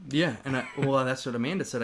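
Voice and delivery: normal talking voice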